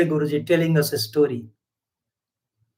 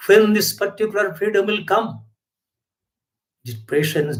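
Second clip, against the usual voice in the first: usual speaking voice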